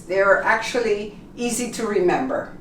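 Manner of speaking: ordinary delivery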